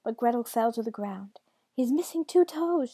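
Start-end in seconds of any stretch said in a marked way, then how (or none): 1.7-2.9 high, loud outburst; distraught cry. A narrator's reading. Girl discovering her father's injury, high distraught cry. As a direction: cry it out with raised pitch.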